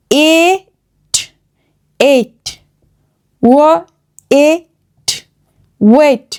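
এট stretched with a separate t, then blended; sounding out. Shown as a demonstration. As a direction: spell out ও, এট by sound, then say ওয়েট. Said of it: "একসঙ্গে বসে এ sound তৈরি হয়". (লম্বা 'এ', ধ্বনি ভেঙে ভেঙে)

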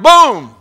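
loud, high mock gunshot "Boom!"; shotgun boom. Preacher acting out firing the shotgun at the duck, loud boom. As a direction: blast it out like the gun firing.

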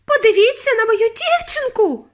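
very high, loud distress; mother's shriek. A narrator's reading. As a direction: shriek for everyone to look. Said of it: "верещала пані Борогар".

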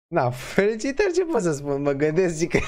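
amused congratulations. Real, not acted. Cracking up at rude build.

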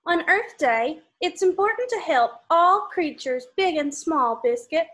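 lively storybook read-aloud; reading to children. Acted. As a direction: read it brightly for little kids. Reading picture book to kids.

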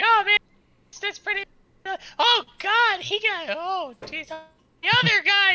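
very high, excited squeak; Rupert's voice. Acted. Rupert gawking at the wounded, squeaky high voice. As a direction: squeal it out, gushing over the injuries. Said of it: "he's like".

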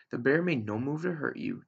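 ordinary delivery

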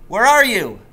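loud, high worried call. A narrator's reading. Boy searching the filling station calls out loudly for dad. Calling for missing father.

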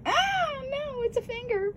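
very high squeaky voice for the pet. Retold or imitated. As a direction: squeak it like a startled little animal. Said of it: "she's all like".

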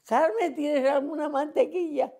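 high sing-song call of the name Carmen; a neighbour's high calling voice. Retold or imitated. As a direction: call across to her, pitched well up.

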